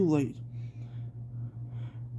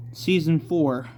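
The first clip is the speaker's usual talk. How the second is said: plain reading voice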